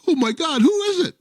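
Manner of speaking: high, rising gasp of shock; shocked lady. Acted. Lady finding the plumber on the floor, high and shocked. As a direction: cry out at the body.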